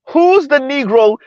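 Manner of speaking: who's the what, really?; incredulous. Real, not acted. Host asking chat in disbelief, high and slow.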